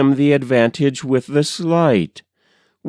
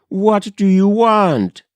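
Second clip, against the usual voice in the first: higher, raised frightened call; cry into the dark; 8 semitones higher than usual. Acted. Wife calls again to the hidden stranger, higher. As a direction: call it out again, pushing harder. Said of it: "she cried".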